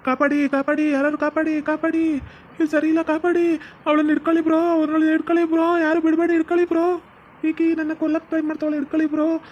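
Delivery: high panicked cries for help; calling for help. Acted. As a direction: yell for rescue from the water. Husband calls for help from water.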